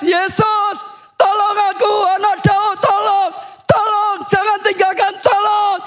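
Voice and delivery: sustained high-pitched shout for help; desperate shouting. Acted. Blind Bartimeus shouts desperately so Jesus will not leave him, shouting. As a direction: cry out harder, each plea held up high. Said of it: "Dia teriak lebih keras".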